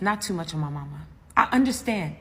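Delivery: ordinary talk, nothing marked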